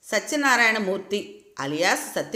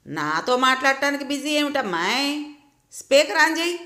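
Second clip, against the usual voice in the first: put-on higher, commanding old grandmother; బామ్మ గొంతులో గట్టిగా; forceful grandma; 6 semitones higher than usual. A narrator's reading. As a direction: boss him around like a bossy granny. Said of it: "బామ్మగారి గొంతు ధాటిగా వినిపిస్తోంది".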